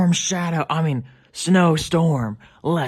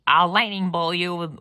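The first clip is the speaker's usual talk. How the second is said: ordinary delivery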